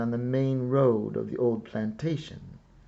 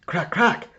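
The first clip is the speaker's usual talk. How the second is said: drum cracks; 7 semitones higher, 6 dB louder than usual. A narrator's reading. Imitating sharp drum cracks.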